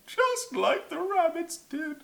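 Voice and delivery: high, slow, whimpering narration; hammed-up tearful squeal; mock crying. A narrator's reading. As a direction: wail the words out slowly. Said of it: "Garblephlax started to cry".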